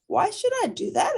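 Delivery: put-on a small child complaining about her tooth; little rabbit voice.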